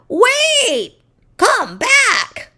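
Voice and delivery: high calling yell. A narrator's reading. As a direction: call after the fleeing daughter. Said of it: "yelled Mama Finn".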